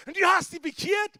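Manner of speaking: loud and high, a startled challenge; retold shouted question. A man in hospital shouts his question at the speaker, loud and high. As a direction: call it out at him loudly.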